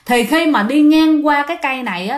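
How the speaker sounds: plain reading voice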